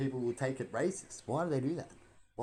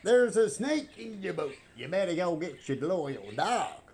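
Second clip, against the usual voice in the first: mock cowboy catchphrase; 5 semitones higher, 3 dB louder than usual. Quoting the cowboy toy catchphrase.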